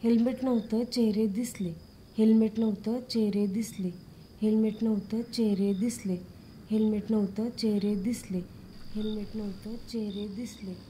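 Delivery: low, soft repetition in an even rhythm; rote memorising. Pretend. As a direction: drill the phrases quietly to memorise them. Memorising dictated testimony by repetition. (घोकंपट्टी, खालच्या आवाजात पाठांतर)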